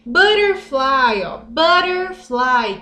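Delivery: butterfly spoken slowly and clearly; drawn out for learners to repeat; slow model word for the class; slow teaching. Shown as a demonstration. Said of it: "teaching vocabulary slowly".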